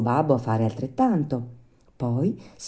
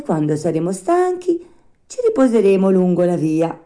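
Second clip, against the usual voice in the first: slightly raised, louder puppet voice; lightly voiced Pinocchio; 5 semitones higher, 6 dB louder than usual. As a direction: encourage a weary father as his puppet son.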